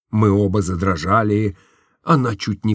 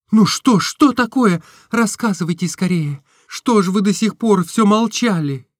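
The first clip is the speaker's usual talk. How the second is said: put-on higher, lighter girlish voice for Nastenka; Nastenka's voice; 10 semitones higher than usual. A narrator's reading. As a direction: voice the young woman, tender and open. (голос Настеньки, выше и легче)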